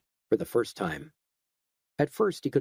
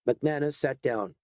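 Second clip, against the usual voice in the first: ordinary delivery, nothing marked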